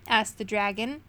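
ordinary delivery, nothing marked

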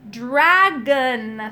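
"Dragon" loud, both syllables drawn out distinctly; "Dragon" said slowly and emphatically, syllable by syllable; very clear enunciation. A narrator's reading. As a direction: pronounce "Dragon" for someone hard of hearing. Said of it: "speaking very clearly".

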